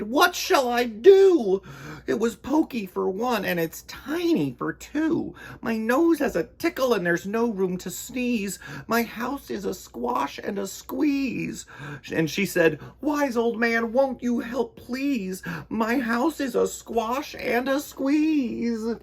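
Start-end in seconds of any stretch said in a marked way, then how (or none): 0.0-11.6 put-on old lady crying out. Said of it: "The little old lady cried". 13.0-19.0 put-on high, pleading little old lady voice; fretful old lady.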